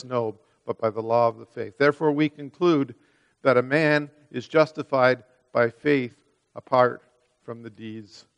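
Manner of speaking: ordinary delivery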